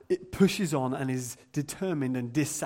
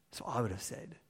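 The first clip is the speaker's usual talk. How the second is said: whispered aside; 5 semitones lower, 10 dB quieter, faster than usual. Real, not acted. Aside after mock rant.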